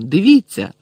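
loud urgent look; urgent exclamation. A narrator's reading. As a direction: demand they look. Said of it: "вигукнув Рон".

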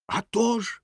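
high, eager agreement; quick-spirited exclamation; exclaiming. A narrator's reading. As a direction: chime in brightly high. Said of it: "вигукнув бенгальський вогонь".

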